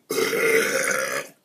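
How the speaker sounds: harsh choking cough; coughing fit. Real, not acted. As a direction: cough hard on the soda. Choking on the soda.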